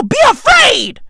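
high, loud exaggerated alarm; hammed-up dire warning; mock ominous warning. Real, not acted. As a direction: warn them loudly, half joking. Warning about guitar-playing women.

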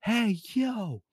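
high, sharp mock teacher yell across the hall; imitating a teacher catching a student in the hallway; teacher calling out. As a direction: bark it at the kid sneaking off. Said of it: "cat eye reflexes is like".

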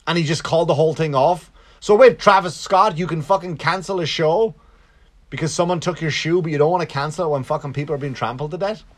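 ranting. Real, not acted. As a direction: call him out in disbelief. Ranting at [name].

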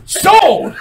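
high, loud one-word shout; emphatic acceptance. Retold or imitated. Kid accepting the counselor's deal, excited shout. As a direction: seize the bargain at full volume.

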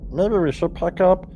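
high small goblin voice; little goblin voice. Acted. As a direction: pipe it up like a tiny goblin. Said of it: "you hear a little voice says".

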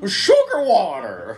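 slow, drawled bug-man voice; Vincent D'Onofrio impression. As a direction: stretch it out like a creepy alien.

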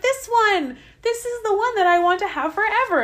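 high, loud, delighted declaration; overjoyed, like finally finding the right one; excited exclamation. Acted. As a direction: gush it high and emphatic. Acting out man's delight at woman.

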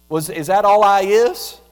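loud, wounded parental complaint; hurt parent. Pretend. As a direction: call out as a parent who only gets asked for things. Parent answers the nagging child.

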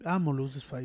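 沈んだ小声; defeated mutter. Retold or imitated. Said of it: "he was like".

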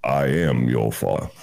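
low, heavy Vader quote; low mock Darth Vader. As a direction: say it deep and grave. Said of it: "he goes".

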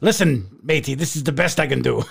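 put-on botched Irish leprechaun accent; leprechaun brogue. Angry leprechaun greeting his friend Jay, bad mock Irish brogue. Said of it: "What is that accent?".

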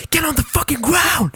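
high, strained mock shout; imitated police yell. Retelling police ordering two men down, imitated yelling. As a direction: yell it like a cop with a gun drawn. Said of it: "they start yelling".

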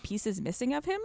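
ordinary talk

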